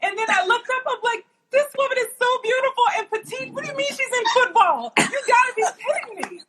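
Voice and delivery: high-pitched retold astonishment; amazed disbelief. Host retelling first sight of the coach, amazed disbelief.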